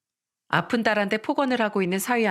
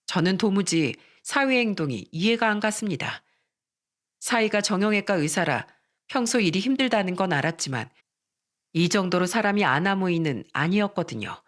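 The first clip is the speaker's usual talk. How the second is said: ordinary delivery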